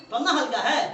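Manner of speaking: laughing it out loud. Real, not acted. Tutor teasing his student, laughing. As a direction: chuckle through the words. Teacher jokes about easy marks.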